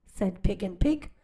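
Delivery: ordinary delivery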